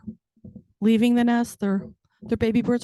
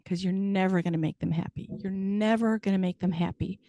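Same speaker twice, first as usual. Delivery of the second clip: ordinary talk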